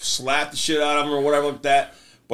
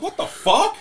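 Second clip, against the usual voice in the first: shocked. Retold or imitated. Friends shocked in retold scene.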